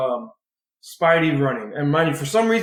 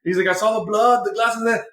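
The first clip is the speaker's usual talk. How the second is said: higher-pitched mock panicked boyfriend; imitating a frantic hero, higher; mock worried Peter; 7 semitones higher than usual. Peter voiced, worried, in retold scene.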